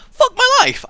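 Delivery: high, loud cry of frustration; exasperated outburst. Real, not acted. Tripping over a show title, exasperated high outburst. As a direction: burst out, fed up with yourself.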